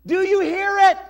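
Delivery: loud, high challenge to the crowd; big pulpit shout; preacher's shout. Real, not acted. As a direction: call it out full force to the congregation. Preacher calls out to congregation.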